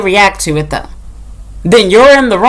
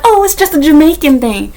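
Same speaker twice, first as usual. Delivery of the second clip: mocking excuse voice; 6 semitones higher than usual. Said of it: "Be like".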